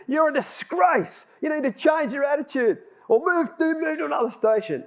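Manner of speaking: high, heated rebuke; blew up angrily. Retold or imitated. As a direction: re-enact laying into a workmate. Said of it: "it came to a head and I blew up".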